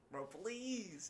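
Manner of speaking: high exasperated "bro, please". Retold or imitated. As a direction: beg him, fed up. Calling out roommate.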